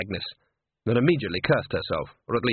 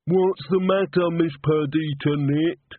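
higher, slow, odd voice; Walter's voice; 5 semitones higher, slower than usual. A narrator's reading. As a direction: voice the odd-job man.

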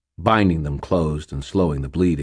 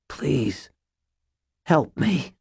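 pleading in pain; 10 semitones higher, much slower than usual. Acted. Begging in pain.

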